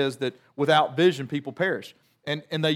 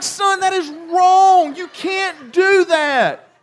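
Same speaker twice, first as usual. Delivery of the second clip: slow, much higher, loud stern yell; scolding shout; 13 semitones higher, 8 dB louder, much slower than usual. Retold or imitated. Father scolding his son over the note, loud slow shout. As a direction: drag out each word as a loud rebuke.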